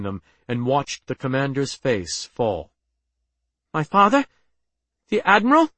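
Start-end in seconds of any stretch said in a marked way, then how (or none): 3.7-5.7 high, rising, startled questions; urgent, disbelieving questions; anxious questioning. A narrator's reading. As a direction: ask in alarm, pitch climbing at each question. Daughter learns of father's fate.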